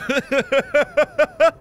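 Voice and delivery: high, stuttering cackle; long villainous laugh; gleeful rattling cackle; maniacal giggle. Acted. Giddy about opening the airlock.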